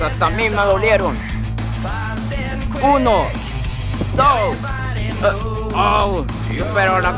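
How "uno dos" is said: referee count. Acted.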